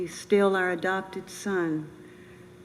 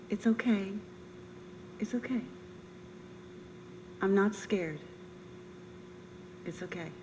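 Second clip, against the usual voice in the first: softly reassuring, fading to a hush at the end; calm them gently as the breath runs out; gentle reassurance; 8 dB quieter than usual. Retold or imitated. Dying son soothing his army friends, softly reassuring and fading.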